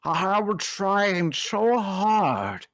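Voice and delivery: a lightly voiced captive triceratops; lightly voiced beast.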